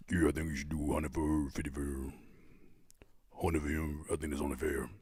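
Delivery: very low, soft mock voice; deep mocking voice. Shown as a demonstration. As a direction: drop right down into a deep mumbled mockery. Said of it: "Deeper voice when mocking, please".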